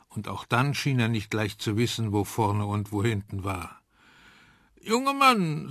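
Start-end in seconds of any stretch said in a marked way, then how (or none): 4.8-5.7 knapp, hoch angesetzter alter Professor; kurz angebunden. A narrator's reading. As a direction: barsch den Besucher abwimmeln. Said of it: "recht kurz angebunden". (kurz angebunden, leicht gespielter Professor)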